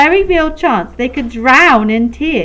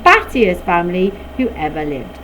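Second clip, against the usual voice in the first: ordinary delivery, nothing marked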